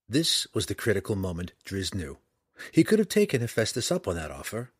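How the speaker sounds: usual speaking voice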